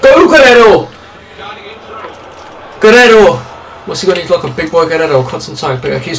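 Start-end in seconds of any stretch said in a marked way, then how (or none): 0.0-3.4 urging shout. Real, not acted. Gamer urging Guerrero forward, excited shout.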